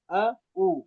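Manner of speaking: اعو broken by a glottal stop instead of ع; ain turned hamza. Shown as a demonstration. Teacher repeating the mistake, hamza in place of ع in اعو. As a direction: say اعو with a hamza, no throat squeeze. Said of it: "حمزہ بن گئی".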